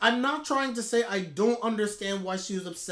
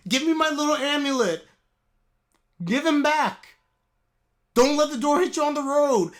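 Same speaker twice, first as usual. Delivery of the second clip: dismissive send-off; 4 semitones higher, 6 dB louder than usual. Pretend. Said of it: "I'd be like".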